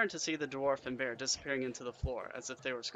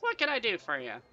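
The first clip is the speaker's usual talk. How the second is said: high, louder barmaid's offer; smiling welcome; 8 semitones higher, 6 dB louder than usual. A narrator's reading. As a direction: offer service with a smile in the voice. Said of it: "she smiles".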